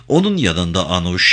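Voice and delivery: ordinary delivery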